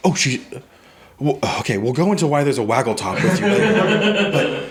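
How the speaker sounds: flustered and amused, brushing it aside; flustered Simone. Acted. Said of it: "She goes".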